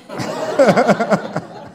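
bright laugh at his own joke. Real, not acted. Pastor laughing after naming his next son, hearty laugh. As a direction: laugh out at your own punchline.

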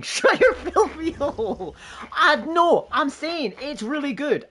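high laughing protest, slow; giggling defence of Star Wars. Real, not acted. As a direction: squeal through the laughter. Laughing banter over Star Wars.

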